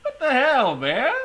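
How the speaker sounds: high, half-crying laughter; laughing through pain. Real, not acted. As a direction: laugh till it hurts. Cracking up over the voice.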